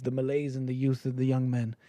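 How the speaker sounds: normal talking voice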